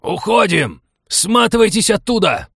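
high, loud panicked scream; frantic yell. A narrator's reading. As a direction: yell at them to get out. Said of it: "дурным голосом заорал".

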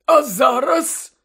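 eager headmaster. A narrator's reading. Dumbledore preparing to view the memory, eager. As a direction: announce it with slow, high anticipation.

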